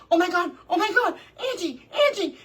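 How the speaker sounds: high, rushed mock-panicked cries; retold panic. Mother-in-law tiptoeing away from the dog, mock panic. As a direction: imitate a frightened visitor calling for help.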